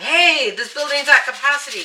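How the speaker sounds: loud joking announcement; yelling. Retold or imitated. Yelling at a guy walking into the empty restaurant, loud mock announcement. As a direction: call it out loud like a bouncer. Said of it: "I yelled at him".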